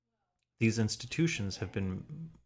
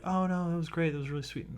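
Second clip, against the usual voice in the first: higher, mock-gushing praise; mock gushing people; 9 semitones higher than usual. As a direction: parody the approving crowd. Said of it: "people are like".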